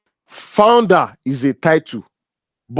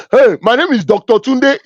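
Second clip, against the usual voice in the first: self-important title-dropper, higher and punchy; Tunde; 7 semitones higher, 4 dB louder than usual. Acted. Man introducing himself by his title, mock self-importance. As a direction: announce your title loudly and proudly, pitched up. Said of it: "how people introduce themselves with titles".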